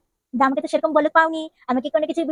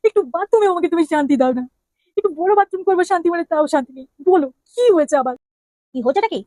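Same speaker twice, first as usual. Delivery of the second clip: put-upon husband voice, a bit raised; annoyed husband; 2 semitones higher than usual. Acted. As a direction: grumble at being disturbed. Husband annoyed, disturbed in bathroom.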